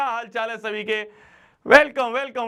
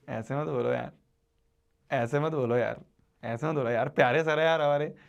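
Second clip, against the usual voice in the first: lower, softer voice of a hurt sir; mock hurt colleague; 6 semitones lower, 4 dB quieter than usual. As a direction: play the colleague gently pleading. Teacher voicing the other sir. (हल्के से नकल करते हुए, धीमी नीची आवाज़ में मनाना)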